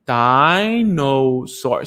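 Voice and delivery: drawn out slowly, syllable by syllable; slow syllable-timed model word. Shown as a demonstration. Therapist slowly modelling the word for the child to copy. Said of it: "Say it together".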